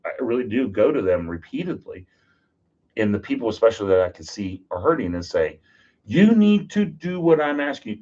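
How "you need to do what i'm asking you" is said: higher, louder, insisting; firm insistence. Retold or imitated. Urging a hurting firefighter, a firm insistent push. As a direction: press the point home.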